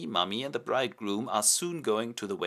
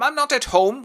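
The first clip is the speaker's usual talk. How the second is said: high, loud called-out order; shout after; 10 dB louder than usual. Acted. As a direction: shout it down the hall. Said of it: "can only shout after him".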